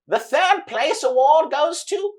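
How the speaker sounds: high, loud, slow showman cry; grand announcer. Acted. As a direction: boom it out to the whole arena. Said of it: "He says".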